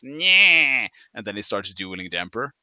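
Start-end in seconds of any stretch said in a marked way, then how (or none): 0.0-0.9 loud mock wail of grief; hammed-up anguished cry. Parodying the scene, a loud mock anguished cry. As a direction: cry out like a stage hero.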